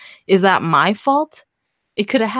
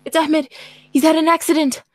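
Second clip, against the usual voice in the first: urgent; 8 semitones higher than usual. Acted. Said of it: "Mo screamed out".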